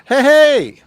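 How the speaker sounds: loud, high "hey" to a guest; shouted greeting. Real, not acted. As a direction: call out a big hearty hello.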